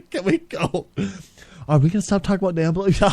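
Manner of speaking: usual speaking voice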